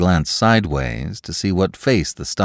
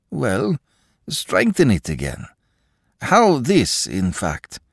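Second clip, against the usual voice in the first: timid pleading; 7 semitones higher, slower than usual. Acted. Akaky pleads, high.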